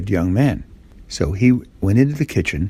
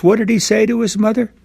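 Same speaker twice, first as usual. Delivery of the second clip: put-on higher, fast teasing question to the kids; quiz question; 11 semitones higher, faster than usual. A narrator's reading. Storyteller quizzing the little listeners, higher and quick. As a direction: ask the children eagerly.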